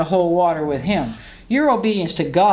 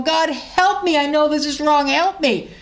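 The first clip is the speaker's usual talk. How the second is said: higher, earnest plea of a struggling sinner; pleading sinner; 8 semitones higher than usual. Retold or imitated. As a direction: beg God for help.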